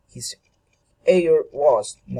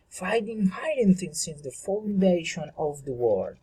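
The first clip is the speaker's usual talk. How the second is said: ordinary delivery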